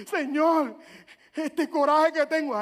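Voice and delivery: loud, slow, pressed plea; acted outburst to God. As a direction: cry out as if owning up to anger. Acted prayer hiding anger. (clamor fuerte y actuado a Dios)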